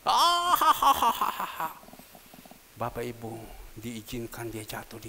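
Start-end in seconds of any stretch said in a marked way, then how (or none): 0.0-1.7 high, loud burst of laughter; mocking laugh. Real, not acted. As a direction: laugh at the taunt. Laughing at the mockery.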